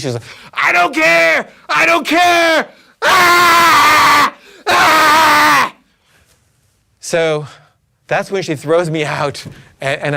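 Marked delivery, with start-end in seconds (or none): 0.5-2.7 loud, high, drawn-out scream. Acted. Wife warned about police, screaming back. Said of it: "Mandy, stop screaming". 3.0-5.7 loud, high wailing screams; screaming. Acted. Wife beyond caring about neighbors, wailing screams. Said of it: "Mandy, stop screaming".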